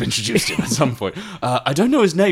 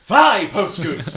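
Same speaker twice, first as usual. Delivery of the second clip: excited; 7 semitones higher, much slower than usual. Acted. Commands the creature.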